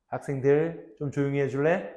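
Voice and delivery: soft-sell polite request, acted; gentle. Teacher courting potential customers among noisy students, gentle request. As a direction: ask kindly and smoothly, slowing at the end. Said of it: "로키 선생님은 참 젠틀하구나".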